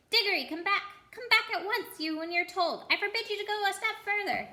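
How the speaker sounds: high, loud, frightened uncle calling; trembling shout. Acted. Uncle Andrew shouting at Diggory from afar, high and loud. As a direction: yell but hold back a little. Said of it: "shouting, but by no means at the top".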